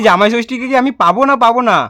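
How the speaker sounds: normal talking voice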